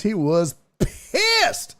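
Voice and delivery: "pissed" stretched out long; stress on pissed. Real, not acted. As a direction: drag out the word "pissed" for comic emphasis. Exaggerates how mad the cat was.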